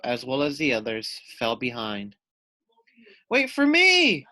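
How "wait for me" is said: much higher, loud cry. Acted. Loony Little falling behind, calling out loud. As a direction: call after the others desperately. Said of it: "cried Loony Little".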